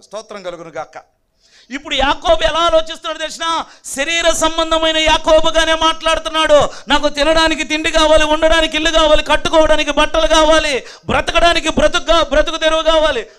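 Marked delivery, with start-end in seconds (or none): 1.7-13.3 loud, higher-pitched pulpit voice; raised, ringing sermon delivery; full-force preaching. Real, not acted. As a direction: proclaim it to the whole congregation.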